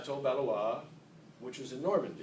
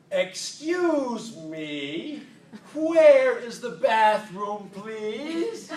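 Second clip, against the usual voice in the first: very slow, much louder and higher bellow; loud slow shout; 7 semitones higher, 9 dB louder, much slower than usual. Retold or imitated. Mother shouting slowly at a foreigner for the bathroom. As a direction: yell it slowly as if volume fixes language. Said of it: "She'd take a deep breath and she'd say".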